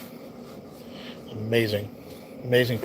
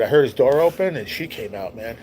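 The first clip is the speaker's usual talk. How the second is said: plain reading voice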